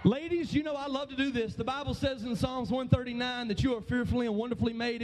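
ordinary talk, nothing marked

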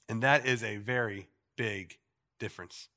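ordinary talk, nothing marked

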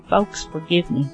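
normal talking voice

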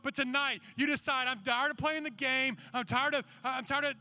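driving, insistent altar-call plea; urgent, emphatic sermon appeal; impassioned preaching. Real, not acted. Urges a decision tonight.

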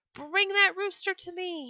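high, loud furious roar; thundering. Acted. Raging Sultan thundering at his slave, high and loud. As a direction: bellow the order in a rage. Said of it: "he thundered".

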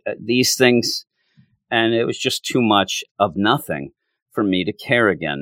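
ordinary delivery

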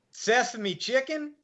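mock the inmate's name like a joke; mocking guard. Guard mocking the Chinese inmate's name, high and slow. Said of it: "replied the C O, laughing uproariously".

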